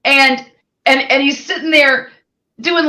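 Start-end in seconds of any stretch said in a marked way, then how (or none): none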